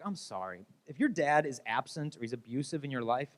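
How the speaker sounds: normal talking voice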